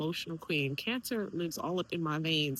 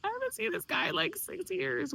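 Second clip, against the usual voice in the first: high-pitched mock incredulity; acted disbelief; 11 semitones higher than usual. Daughter retells reacting to father's claim, high and incredulous. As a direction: protest it high, as if baffled.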